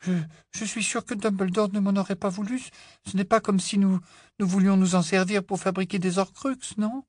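high-pitched, hesitant plea; pleading. A narrator's reading. Hermione seeking her friends' approval, pleading. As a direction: beg to be believed, voice held high. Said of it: "d'un air suppliant".

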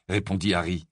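ordinary delivery

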